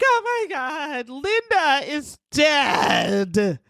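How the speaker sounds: slow, high, shouted panic; hysterical. Retold or imitated. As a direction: wail it out like a hysterical teen. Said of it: "Patty's hysterical outside".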